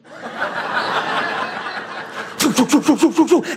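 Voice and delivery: rapid puffed running noises; imitated scampering. Pastor mimics his dog bolting for the seal, imitated scampering. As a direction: imitate a dog's legs taking off at speed.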